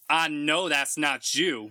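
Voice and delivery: slow, drawn-out sassy nurse; a lightly voiced sassy nurse teasing. The nurse teasing the patient, lightly sassy.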